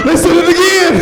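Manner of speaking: high, laughing outburst; scandalized high squeal of disbelief; shocked disbelief. Acted. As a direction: blurt it out high, cracking up. Shocked reaction to the kids' word.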